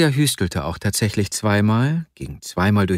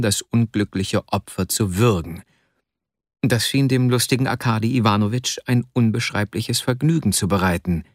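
ordinary delivery, nothing marked

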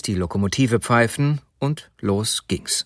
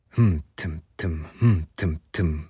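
train chug imitation; 6 semitones lower than usual. A narrator's reading. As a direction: imitate a train with little low puffs. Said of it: "machte der Zug".

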